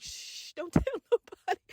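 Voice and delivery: whispered shush, soft secretive plea; conspiratorial hush. Retold or imitated. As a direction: hush a younger sibling to keep a secret. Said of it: "older siblings used to be like".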